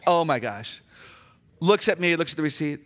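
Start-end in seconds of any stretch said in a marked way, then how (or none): none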